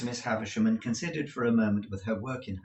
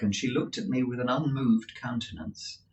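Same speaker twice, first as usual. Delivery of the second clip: usual speaking voice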